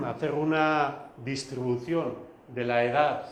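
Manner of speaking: ordinary talk, nothing marked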